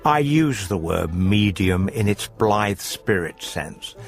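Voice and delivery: ordinary talk, nothing marked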